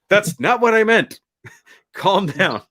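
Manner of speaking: high, defensive protest with a laugh; mock protest. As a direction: imitate a son backpedalling to an angry dad. Acted son backing off from father.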